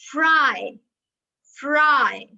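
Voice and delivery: "fry" loud, R clearly sounded before the vowel; R pronounced. Shown as a demonstration. Teacher repeats an example for the class, R pronounced. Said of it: "So you will pronounce the R".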